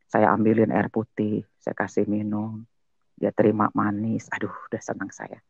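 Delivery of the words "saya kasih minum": plain reading voice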